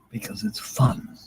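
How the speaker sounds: whispered aside, breathy and hushed. Pretend. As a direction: murmur it to yourself like a secret. Answers self in whisper.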